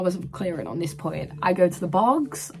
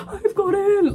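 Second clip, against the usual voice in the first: much higher, louder burst of disbelieving joy; overjoyed cry; 13 semitones higher, 5 dB louder than usual. Retold or imitated. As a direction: blurt it out through tears of joy.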